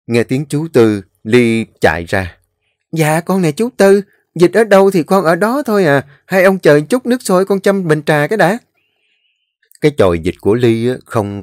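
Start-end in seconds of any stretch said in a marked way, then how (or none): 2.9-8.6 put-on raised, polite young woman's voice; sweet and deferential to the elders. Ly's voice in dialogue. (giọng cô gái trẻ cao, lễ phép)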